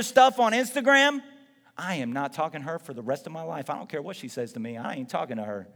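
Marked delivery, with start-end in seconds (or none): 1.8-5.7 mock sulky grudge. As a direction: lower and softer, a pouty stubborn mutter. Sulking adult refusing to reconcile.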